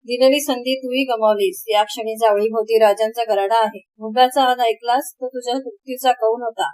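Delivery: plain reading voice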